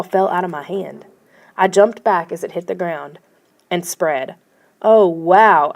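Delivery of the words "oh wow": loud, startled oh wow; mild shriek. A narrator's reading. Boy watching the snow spread, a loud startled cry. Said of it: "I shrieked".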